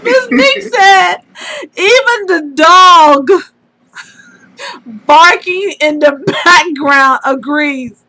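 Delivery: spaced out slowly, phrase by phrase; slow repeat. Real, not acted. Friend repeating the punchline for a confused listener, slowly. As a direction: draw out each phrase so it lands.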